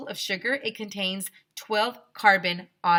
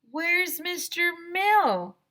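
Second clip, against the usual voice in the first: worried question; 11 semitones higher, 5 dB louder, slower than usual. Acted. Kid calling out worried.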